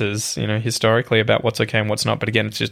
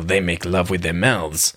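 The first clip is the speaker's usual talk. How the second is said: low, slow mock French accent; hammed-up French accent, drawn out with disdain; 5 semitones lower, slower than usual. Film character sneering at the French, in a mistaken French accent. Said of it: "saying that in a French accent".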